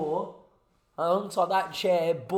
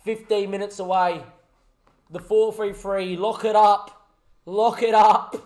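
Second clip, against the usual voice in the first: lively play-by-play, louder at moments; match caller willing the final whistle; live commentary; 6 dB louder than usual. Acted. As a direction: narrate the closing minutes like a broadcaster.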